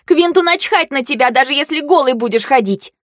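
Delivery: high-pitched quarrelsome jibe; catty taunt. A narrator's reading. Girl mocking her rival's dress, high and catty. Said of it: "выдала Фэй-Фэй".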